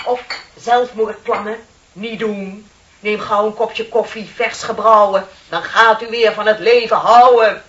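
building to a loud, hammed-up ad announcer finish; mock commercial pitch. Mocking a coffee ad for her colleague, rising to loud. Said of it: "die reclameteksten maken".

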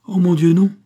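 a loud, aggrieved denial; bitter. A narrator's reading. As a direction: cry out in bitter protest. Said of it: "dit amèrement Pitou".

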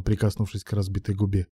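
usual speaking voice

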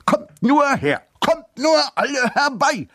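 viel höher, herausfordernd gebrüllt; brüll die Menge herbei. A narrator's reading. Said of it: "brüllte er". (herausforderndes Brüllen zur Menge)

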